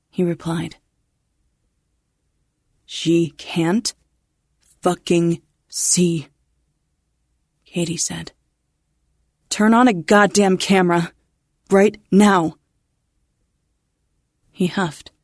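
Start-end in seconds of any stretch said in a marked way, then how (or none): 2.9-6.3 slow, bitten-off fury; angry. Acted. Katie snapping at the technician, slow and angry. 9.5-12.6 louder, commanding order; angry demand. Acted. Katie ordering the technician, loud and angry.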